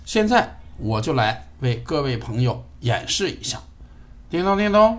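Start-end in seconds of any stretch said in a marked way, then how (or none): 4.3-5.0 high, loud sing-song call; calling wake word. Real, not acted. Wake word called to speaker.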